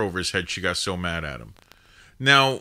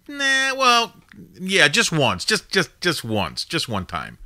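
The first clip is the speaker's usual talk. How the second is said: smirking maitre d; 7 semitones higher, 3 dB louder, slower than usual. Retold or imitated.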